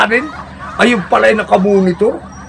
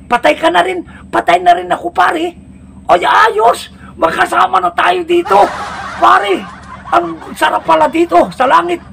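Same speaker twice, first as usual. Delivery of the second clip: put-on high-pitched happy drunkard; excited drunk buddy; 11 semitones higher than usual. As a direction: cheer like a drunk friend reunited. Drunk buddies meet in heaven.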